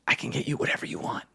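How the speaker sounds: hushed conspiratorial whisper. Acted. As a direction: whisper the bribe so no one hears. Prisoner whispering a secret offer.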